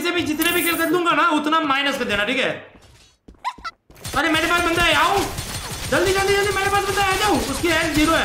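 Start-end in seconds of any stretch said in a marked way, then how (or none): 4.2-8.2 excited call. Real, not acted. Calling teammates to an enemy.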